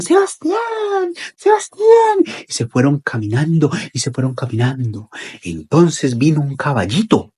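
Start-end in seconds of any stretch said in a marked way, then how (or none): 0.0-2.2 put-on high, long-drawn call for a lost child; acted parents' searching call. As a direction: call the name far across the fields. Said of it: "Y llamaban".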